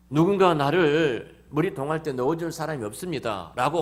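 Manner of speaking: loud, high grievance; the sick man's loud lament; complaining cry. Acted.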